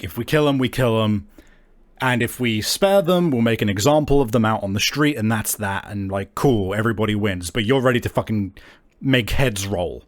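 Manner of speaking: normal talking voice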